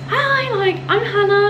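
high, loud, bubbly hello; retelling an overexcited hello to neighbours; excited greeting. Retold or imitated. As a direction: gush the intro like meeting new friends. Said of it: "I was just so excited".

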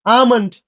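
"আমন্ড" without any L; "আমন্ড" going from open "aa" straight to M; correct pronunciation. Shown as a demonstration. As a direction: model "আমন্ড" as aa-mond. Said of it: "এই এল এর উচ্চারণটা নাই".

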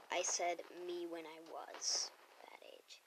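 hushed whispered correction; whispered confession; whispered aside. Real, not acted. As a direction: whisper it as a private clarification. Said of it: "unvoiced whisper".

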